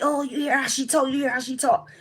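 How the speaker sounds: high, excited mimicry of a New Yorker; imitated amazed onlooker. New Yorker pointing out how she talks, imitated excitement.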